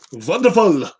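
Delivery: wonderful as "vonderful", German V; mock German "vonderful"; German accent. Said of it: "Do my German accent".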